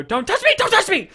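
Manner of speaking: imitating a frightened queen, high and loud; frantic recoil. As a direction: shriek it like a startled queen recoiling. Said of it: "Olivia Colman of just like".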